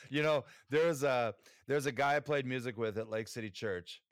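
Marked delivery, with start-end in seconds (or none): none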